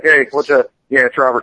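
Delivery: a casual, relaxed Robert Kirkman, lightly voiced; lightly voiced writer answering the phone casually; low-key Kirkman impression. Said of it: "if I was doing Kirkman".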